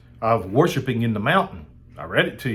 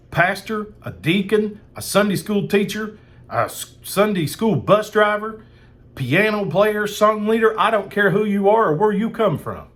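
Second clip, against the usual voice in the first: rising preacher's fervor, voice lifted high; fired up; 6 semitones higher than usual. Real, not acted. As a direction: pitch raised high, preaching hard at every role in the list. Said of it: "I'm fired up about it".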